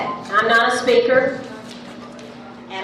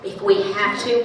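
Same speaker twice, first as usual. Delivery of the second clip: usual speaking voice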